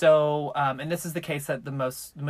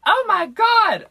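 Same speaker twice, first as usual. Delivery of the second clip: high, loud mock gasp of shock; fake surprise; 12 dB louder than usual. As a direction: overplay the surprise, shrieking. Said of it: "bitch, act surprised".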